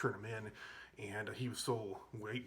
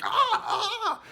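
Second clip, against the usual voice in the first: very high, very loud laughing shriek; excited yelp; 13 dB louder than usual. Retold or imitated. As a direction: let out a wild, laughing shriek of joy. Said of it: "so excited about it".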